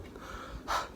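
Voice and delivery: long exhausted breath out; weary sigh. Real, not acted. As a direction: sigh out, worn down. Tired late at night.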